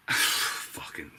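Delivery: low breathy sighed curse; a fed-up sigh into the word Fucking; exasperated sigh. Real, not acted.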